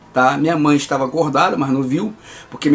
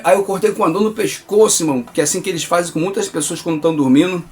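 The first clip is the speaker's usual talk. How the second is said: ordinary talk, nothing marked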